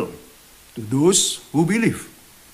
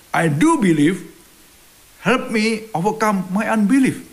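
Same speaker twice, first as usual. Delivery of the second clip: exclaiming; 7 semitones higher, 4 dB louder, slower than usual. Retold or imitated. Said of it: "the boy's father exclaimed".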